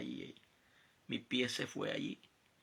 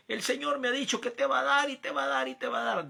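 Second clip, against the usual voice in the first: higher, louder hammed-up pulpit promise; mock a preacher promising riches; mocking false prophet's loud promise; 12 semitones higher, 9 dB louder than usual. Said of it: "desde un púlpito le dice".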